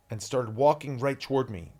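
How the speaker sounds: usual speaking voice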